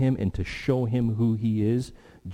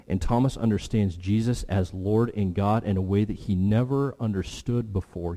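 ordinary talk, nothing marked

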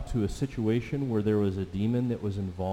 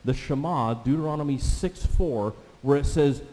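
ordinary talk, nothing marked

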